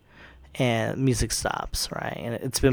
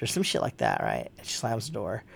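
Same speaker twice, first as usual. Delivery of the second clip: ordinary talk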